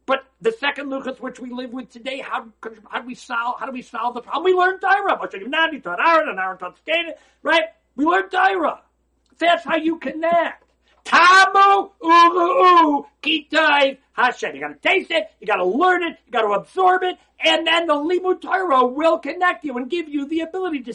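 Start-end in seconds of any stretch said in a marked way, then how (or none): 4.4-10.6 loud, high preaching at full force; rising sermon fervour; fiery preaching. Acted. Rabbi rouses listeners on Torah. 11.0-14.6 tearful plea. Acted. Impassioned verse in sermon climax. 14.6-20.9 loud, high preaching at full force; rising sermon fervour; fiery preaching. Acted. Rabbi rouses listeners on Torah.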